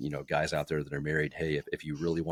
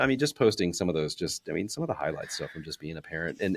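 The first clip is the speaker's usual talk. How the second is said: ordinary talk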